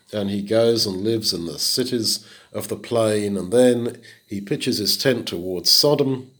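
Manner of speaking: ordinary delivery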